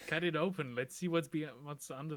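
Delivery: excited call. Real, not acted. Eager to open sealed door.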